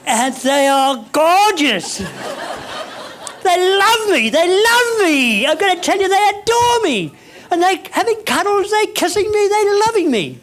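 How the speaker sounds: high, loud, rapturous boasting; elated gushing. Retold or imitated. Comic boasting to the audience that they adore me, elated. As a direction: gush it out high and loud, drawing out the key words.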